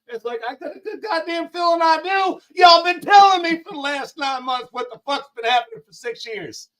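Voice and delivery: laughs and shouts it out at the chat; laughing shout. Real, not acted. Streamer shouting at his viewers, laughing. Ranting about viewers' news.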